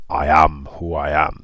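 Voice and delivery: slow, deep divine name; solemn, deep-voiced pronouncement; big deep God voice. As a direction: intone it as God, drawn out. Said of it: "in that big, deep voice".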